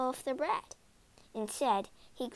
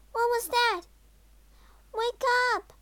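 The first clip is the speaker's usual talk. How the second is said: put-on high, loud alarmed girl's voice; startled cry; 11 semitones higher, 6 dB louder than usual. A narrator's reading. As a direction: cry out to wake someone. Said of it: "cried Gretel".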